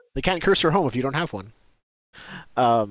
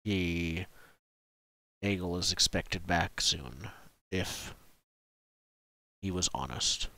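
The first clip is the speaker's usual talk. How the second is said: low, soft, halting chieftain voice; grave village chief; 5 semitones lower, 7 dB quieter, slower than usual. Acted. Chief telling travellers of his awaited son, gravely. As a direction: speak low, pausing before the doubt.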